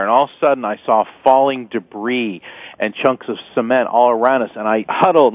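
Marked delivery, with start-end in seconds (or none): none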